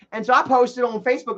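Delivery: normal talking voice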